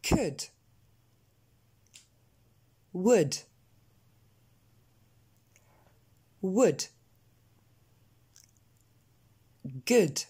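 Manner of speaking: "Could", "Would", "Good", "Cook" each with a short eu-like vowel; isolated words, "Would" and "Good" lax, no long ou; pronunciation model. Shown as a demonstration. Teacher drilling a word series for repetition, pronunciation model. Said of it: "une série de mots".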